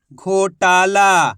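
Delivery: घोटाला stretched very slowly, syllable by syllable; drawn out word. Shown as a demonstration. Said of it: "एक ही अक्षर का उच्चारण करके इनको पढ़ रहा हूँ".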